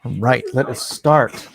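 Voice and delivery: ordinary talk, nothing marked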